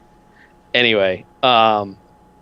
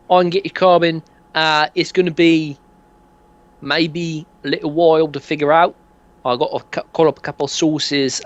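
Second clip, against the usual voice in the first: higher, chatty geezer pitch; dodgy dealer; 6 semitones higher, 3 dB louder than usual. Acted. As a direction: sell it like a dodgy mate. Dealer keeps selling.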